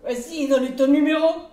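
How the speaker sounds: high, loud, pushy groom voice; cheeky groom. Acted. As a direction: egg her on like a rowdy wedding guest. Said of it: "lui dit".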